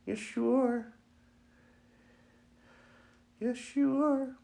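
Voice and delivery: very high-pitched cooing to a puppy; sing-song pet-owner squeal. Real, not acted.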